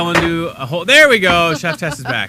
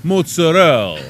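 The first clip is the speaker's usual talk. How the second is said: "Mozzarella" as "motsorel", louder, Italian vowels; Italian accent; 3 semitones higher, 6 dB louder than usual. Shown as a demonstration. Italian host showing how his people say it, Italian accent. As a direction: say it like a proud Italian. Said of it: "Italians, we say things differently".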